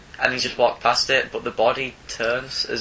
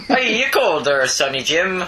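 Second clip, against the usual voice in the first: put-on Northern accent; 2 semitones higher, slower than usual. Vader offers warmth in a Northern accent. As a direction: offer to warm him up like a kindly Northerner. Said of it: "What accent you're doing?".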